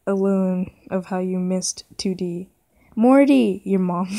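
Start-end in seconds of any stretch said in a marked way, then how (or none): 3.0-3.6 louder, higher mom's call; yelled. Acted. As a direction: yell for the kid as mom. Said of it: "yelled your mom".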